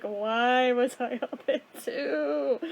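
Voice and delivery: slow, drawn-out mock whine; weary complaint. Complaining about being up since two, weary. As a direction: drag the words out in tired dismay.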